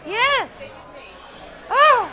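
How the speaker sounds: loud, high whoops of joy; excited cheer. Real, not acted. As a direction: shout with delight. Cheering at the proposal.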